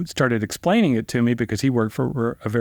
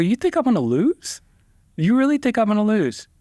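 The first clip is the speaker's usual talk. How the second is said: higher, pressing, a bit alarmed; anxious disbelief; 11 semitones higher than usual. Retold or imitated. Lawyer asking paralegal if he'll lose, higher anxious disbelief. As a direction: ask it like you suddenly doubt yourself.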